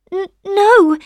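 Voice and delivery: high, stammered little no; hesitant rosebud refusal. A narrator's reading. Rosebud refusing the sunshine, high hesitant voice. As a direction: stumble on it, pitched high.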